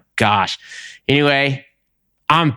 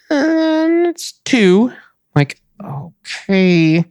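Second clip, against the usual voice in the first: drawn-out waiting; 11 semitones higher, much slower than usual. Acted. Waiting all day for truck.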